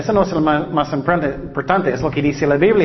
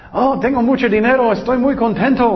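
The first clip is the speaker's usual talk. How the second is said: lightly raised cheery voice; mock happy; 6 semitones higher than usual. Preacher mimicking a happy person to the congregation, light mock cheer. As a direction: play the giddy rich man.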